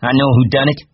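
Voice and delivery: high, loud outburst; angry cry. A narrator's reading. Curly accuses Lenny before the men, angry cry. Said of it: "he cried".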